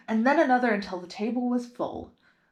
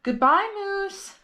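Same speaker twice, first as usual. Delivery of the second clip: high, slow drawn-out farewell; a girl reluctantly parting from her dog; sad goodbye; 12 semitones higher than usual. A narrator's reading. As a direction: let the goodbye linger sadly.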